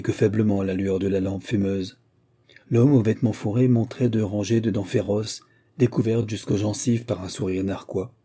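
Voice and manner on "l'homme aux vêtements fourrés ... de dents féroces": usual speaking voice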